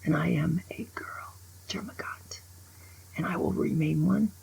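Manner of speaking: hushed, proud confession; quiet defiance; whispered declaration. Real, not acted. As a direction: whisper it like a vow.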